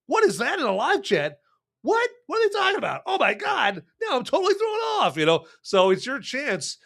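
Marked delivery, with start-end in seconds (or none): 0.0-5.2 high, mock-baffled rambling, getting more rattled; flustered confusion. Host acting rattled by the live chat, high mock confusion. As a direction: act thrown off by a weird chat message. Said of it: "where I'm like".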